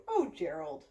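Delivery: plain reading voice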